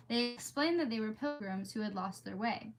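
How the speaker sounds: ordinary talk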